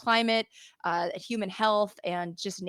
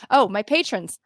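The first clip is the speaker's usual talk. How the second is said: excited thanks; 5 semitones higher, 8 dB louder than usual. Real, not acted. Reacting to patrons list.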